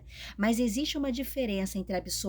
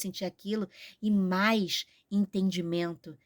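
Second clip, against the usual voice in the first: ordinary talk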